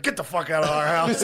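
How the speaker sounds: loud, gruff shout at an intruder; mock angry yell. Couple yelling at the intruder, mock furious shout. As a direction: yell them out of the house. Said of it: "and they're like".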